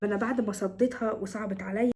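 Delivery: ordinary talk, nothing marked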